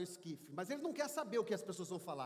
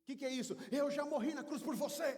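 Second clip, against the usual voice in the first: raised, higher voice building in force; impassioned rebuke; 5 semitones higher, 3 dB louder than usual. Acted. Jesus recalls his suffering on the cross, fervently. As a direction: preach it at full intensity.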